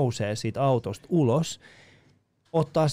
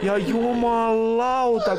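laughing; 9 semitones higher, much slower than usual. Real, not acted. Laughing after the prayer call.

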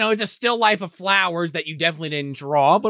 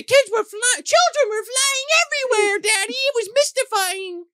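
put-on excited little girl. Melanie tells Mikey she saw leprechauns, very high. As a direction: insist on it like a thrilled little girl.

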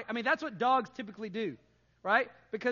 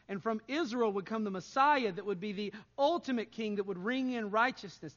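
ordinary talk, nothing marked

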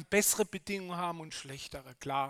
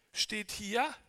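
ordinary delivery

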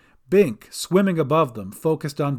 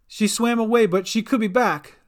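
higher voice, catching breath; between breaths; 7 semitones higher, 4 dB louder than usual. A narrator's reading. Gran answers after surfacing, between breaths. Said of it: "said Gran between breaths".

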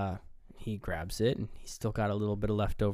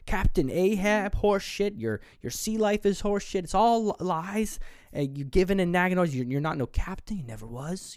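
imitated tirade; 7 semitones higher than usual. Retelling the angry tirade.

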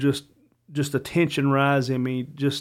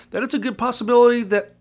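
a higher, heated outburst that keeps climbing; heated anger; 7 semitones higher than usual. Real, not acted. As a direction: let real anger push the voice up. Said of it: "I'm pissed off and I'm angry".

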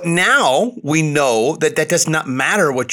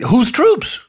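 high, loud scoffing "whose troops?"; incredulous; 10 semitones higher, 4 dB louder than usual. Acted. Host mocking the president's troop blessing, incredulous. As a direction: throw the question back in mock disbelief.

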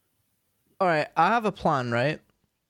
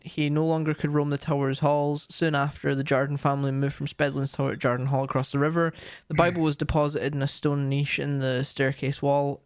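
normal talking voice

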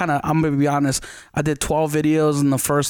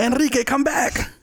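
telenovela drama; 9 semitones higher than usual. Retold or imitated. Mimicking a Spanish soap opera.

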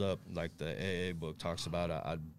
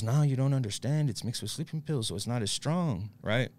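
higher, louder pushy dealer; insistent pitch; 6 semitones higher, 6 dB louder than usual. Retold or imitated. Dealer insisting to the hesitant buyer, loud and pushy. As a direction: sell it hard, talking over the doubt.

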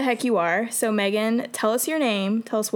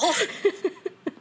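high giggle; 9 semitones higher than usual. Real, not acted. Laughing at best angle.

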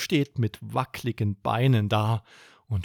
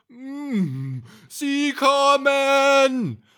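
sehr langsam, laut und gedehnt; donnernde Bärenstimme; 5 dB louder, much slower than usual. Acted. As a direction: lass es wie ein Bär über den Flur donnern. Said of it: "donnert eine Bärenstimme". (Bär warnt laut donnernd)

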